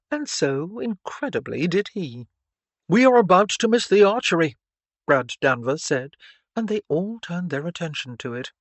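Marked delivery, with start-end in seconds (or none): 2.9-4.6 loud, fast, higher announcement; calling out. Acted. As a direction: hurry everyone along with a raised voice. Brad calls the group to attention.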